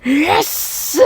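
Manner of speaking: loud, high victory yell; excited cheer. Real, not acted. Celebrating the pick, a loud high cheer. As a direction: cheer as if you just won.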